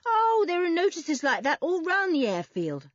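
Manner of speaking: high, breezy dismissal; airy. A narrator's reading. Boy dismissing the warning notices, airily. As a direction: wave it away airily. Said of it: "said Toby airily".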